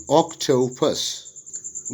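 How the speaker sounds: "octopus" slowly split as oc, to, pus; syllable by syllable. Shown as a demonstration. Teacher showing syllable division, "octopus" broken into syllables. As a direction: say "octopus" slowly in three separate beats. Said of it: "Syllable division".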